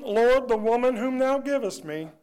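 a hint of whiny Adam blaming; lightly voiced excuse to God, old King James style; half-hearted mock of Adam's excuse. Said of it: "Adam's old trick".

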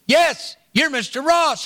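schoolboy telling the pastor who he is, high and eager; young boy voice. Retold or imitated. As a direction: pitch it up like a third grader answering. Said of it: "this young boy's voice".

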